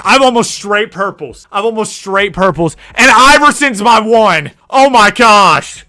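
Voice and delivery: loud, high, thrilled yelling; overjoyed gamer shouting at his picks. Real, not acted. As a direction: yell with delight at the best draft. Thrilled by his best draft.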